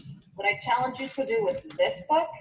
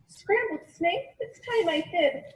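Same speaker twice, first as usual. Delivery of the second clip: snake startled into hiding; alarmed snake; 8 semitones higher than usual. Acted.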